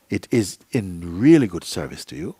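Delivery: usual speaking voice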